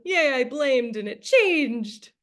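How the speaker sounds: high, drawn-out playful cheer; hammed-up little victory yay; mock celebration. Acted celebration of blaming working.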